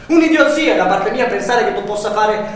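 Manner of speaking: loud, mocking, half-laughing; loud bitter sarcasm. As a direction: shout the sarcasm with a sour laugh. Bitter sarcasm during quarrel. (sarcasmo amaro ad alta voce)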